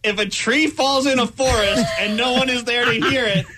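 pompous speaker. Pretend. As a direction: build a fake profound finale. Mock commencement ending.

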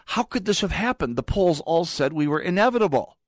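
mock bewildered supporter. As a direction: play a dazed supporter who can't believe it. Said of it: "Sort of like Hillary Clinton supporters".

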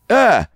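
sudden high yelp of surprise; startled yelp. Retold or imitated. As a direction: yelp as the glass flies at you. Dodging a thrown wine glass.

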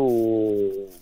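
normal talking voice

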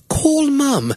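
high-pitched put-on British accent. Shown as a demonstration. Driver tells British Siri to phone mom, mock British accent. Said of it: "speak in a British accent".

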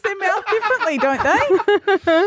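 laughing while talking; words bubbling with laughter. Real, not acted. Laughing mid-argument over lips.

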